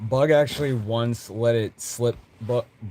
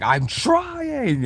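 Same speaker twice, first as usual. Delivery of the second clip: much higher, drawn-out whine; high strained Vessel; 3 dB louder than usual. Acted. Vessel whining to Bug while failing. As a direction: stretch it into a helpless whine.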